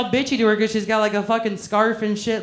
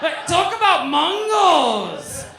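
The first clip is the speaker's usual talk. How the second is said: slow, higher mocking drawl of "Mongols"; mock accent; 6 semitones higher, 4 dB louder, much slower than usual. Comic teasing a front-row woman, slow mock accent. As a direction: stretch it out, mimicking her.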